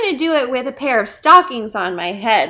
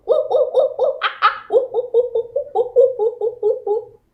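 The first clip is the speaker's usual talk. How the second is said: high playful monkey hoots with a laugh; monkey noises; 11 semitones higher than usual. Acted. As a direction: hoot like a cheeky monkey for the kids. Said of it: "Would you make some noises?".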